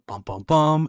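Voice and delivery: high-pitched bum-bum-bum; hammed-up cliffhanger sound; mock suspense sting. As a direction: punctuate the twist with a mock sting. Mock suspense sting at plot twist.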